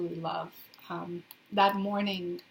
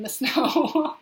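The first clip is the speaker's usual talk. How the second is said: real laughter breaking into the words; laughing; 6 semitones higher, 9 dB louder than usual. Real, not acted. To viewers about snowy Canada, laughing. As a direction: laugh through it, louder. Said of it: "I'm laughing".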